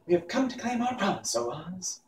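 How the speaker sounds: put-on a child calling out; Dorothy.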